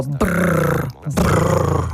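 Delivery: buzzing "brrr" with loose lips; voiced motorboat lip flutter; voiced lip trill. Shown as a demonstration. Trainer demonstrating a lip warm-up, voiced lip trill. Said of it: "а є ще дзвінко".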